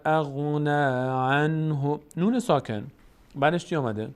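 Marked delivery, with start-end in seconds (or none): 0.0-2.0 slow, drawn-out tajwid recitation; Quran recitation. Shown as a demonstration. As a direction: chant each word slowly. Recites verse.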